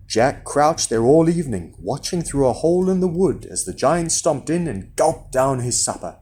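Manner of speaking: usual speaking voice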